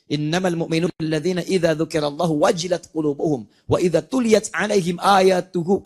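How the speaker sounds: measured recitation of the verse, slowing toward the end; Quran recitation. Acted. Teacher cites surah eight, recited. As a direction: recite it as scripture, set apart from the talk.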